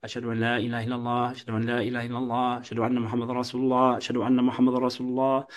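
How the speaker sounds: quick and soft, just for those nearby; speaking level. Shown as a demonstration. Teacher showing the first, quiet shahada round, quick and soft. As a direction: rattle it off at talking volume, not under your breath. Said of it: "the first time".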